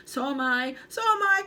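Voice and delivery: high, loud frightened cry. Acted. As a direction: cry out in dismay. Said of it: "cried Mister Twit".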